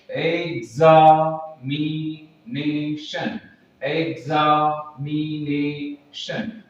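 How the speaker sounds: Examination sung out very slowly, syllable by syllable; stretched-out syllables. Shown as a demonstration. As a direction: draw out examination in a singsong. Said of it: "जब आप examinations को बोलते हैं".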